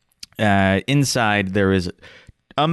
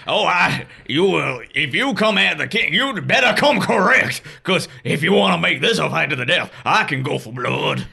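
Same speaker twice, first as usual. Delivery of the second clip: high, fast hillbilly rant; angry country bumpkin; 6 semitones higher than usual. Acted. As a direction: rant like a furious backwoods father. GM father voice threatening.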